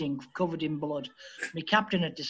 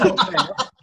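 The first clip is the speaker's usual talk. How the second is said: laughing through the words; 2 semitones higher, 8 dB louder than usual. Real, not acted. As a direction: chuckle it out with real amusement. Laughing while retelling own reply.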